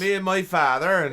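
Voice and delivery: high, loud West Country burr; Devon accent. Pretend. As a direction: put on the rural Devon accent. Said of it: "one of them Hobbit accents".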